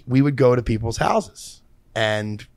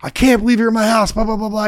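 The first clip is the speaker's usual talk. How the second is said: put-on higher, a kid losing it with joy; excited kid freaking out; 8 semitones higher than usual. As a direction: act out a fan freaking out. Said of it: "the kid fucking freaks the fuck out".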